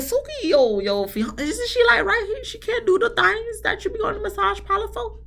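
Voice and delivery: high-pitched disbelief aimed at Suki; incredulous high voice. Retold or imitated. Recapper asking Suki in mock disbelief. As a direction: squeak it out, baffled.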